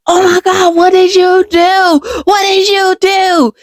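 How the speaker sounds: high, drawn-out horrified questioning; alarmed cry. Retold or imitated. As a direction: cry out in alarm, reliving the shock. Retold shock at brother's bloody knuckles.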